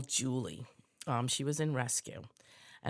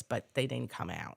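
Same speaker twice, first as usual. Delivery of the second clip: ordinary talk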